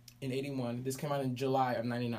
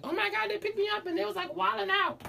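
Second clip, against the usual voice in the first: put-on much higher, quick gushing girl voice. Host mimicking [name], high and excited. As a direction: squeal it like an excited little sister. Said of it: "She said, she's like".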